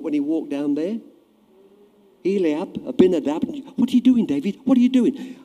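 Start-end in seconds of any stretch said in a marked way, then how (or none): 3.8-5.4 brothers' scolding. Retold or imitated. Brothers scolding David, acted.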